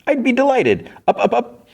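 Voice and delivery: very high, delighted courtly voice; gallant bug. Retold or imitated. Bug agrees to guide Fiona, high gallant delight. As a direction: play an eager little gentleman guide.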